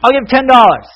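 high, loud shout from the back; yell. Acted. Man at the back of the auction bids, yelling. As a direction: yell out a bid across the room. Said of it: "this one man yells out".

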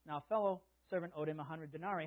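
ordinary delivery

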